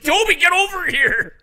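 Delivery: very high, loud imitated yell; re-enacted panicked owner's shout; shouting at the dog. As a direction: holler urgently for the dog. Dog owner calling dog again.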